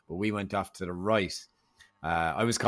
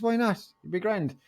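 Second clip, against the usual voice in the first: much higher cheery why not; breezy encouragement; 13 semitones higher than usual. Retold or imitated. Urging Paddy to climb, high and breezy. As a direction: chirp it up lightly. Egging friend on.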